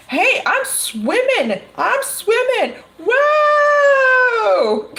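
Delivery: a joyful, high-pitched swimmer calling across the pool; gleeful swimmer. Pretend. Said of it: "you're standing there at the other side going".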